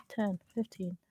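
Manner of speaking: ordinary talk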